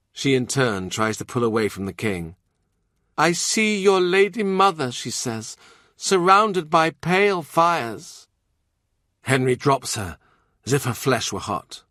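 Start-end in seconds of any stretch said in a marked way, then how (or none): 3.2-8.3 eerie visionary voice. Acted.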